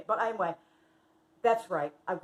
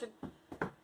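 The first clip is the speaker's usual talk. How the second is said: ordinary talk, nothing marked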